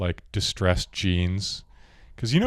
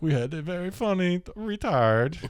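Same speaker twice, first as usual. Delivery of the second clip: Borat impression; 11 semitones higher than usual. Woman quoting Borat to a counselor, high accent and mock laughter. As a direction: do Borat cackling ha ha. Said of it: "he's like".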